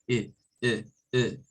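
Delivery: short, lax i sound on E; teacher repeating a brief, low i sound; short vowel demo. Shown as a demonstration. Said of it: "with short E".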